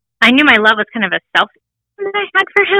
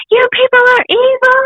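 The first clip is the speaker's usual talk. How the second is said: high-pitched imitation of a child's scream; mock screaming child; 3 dB louder than usual. Mother imitating her son screaming at nurses during shots, mock scream. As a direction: yell it like a screaming kid, much higher but not full volume. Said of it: "He'd be screaming".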